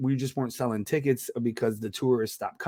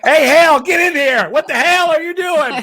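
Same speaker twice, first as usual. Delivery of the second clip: hammed-up dad yelling for his son, much higher and louder; 12 dB louder than usual. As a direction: bellow at the kid from the other room, mock angry.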